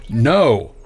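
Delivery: loud, final no; emphatic refusal. Acted. Boss refusing his lawyer on the phone, emphatic. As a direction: shut them down with one loud word.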